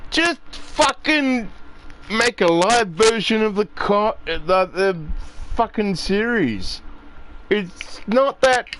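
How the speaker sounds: high, heated venting; frustrated rant. Real, not acted. Fan ranting at the movie makers, high and heated.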